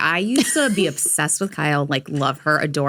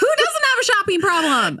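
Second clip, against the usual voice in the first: mock indignant; 13 semitones higher than usual. Mock outrage defending shopping.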